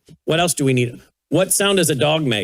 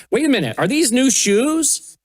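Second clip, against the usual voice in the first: mock amazement; 7 semitones higher than usual. Noticing toddler's new shoes.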